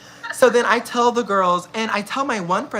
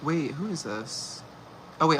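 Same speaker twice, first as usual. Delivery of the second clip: normal talking voice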